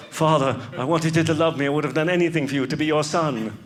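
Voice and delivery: earnest put-on British accent; Joaquin Phoenix. Roman son to his father in Gladiator, British accent. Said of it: "Why are you doing a British accent?".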